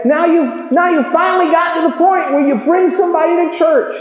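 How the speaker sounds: preaching shout. Real, not acted. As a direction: shout it out to the congregation.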